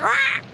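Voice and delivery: short, high-pitched bird squawk; parrot squawk. Acted. Joke teller voicing the lady's bird, high squawk. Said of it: "And that bird's like".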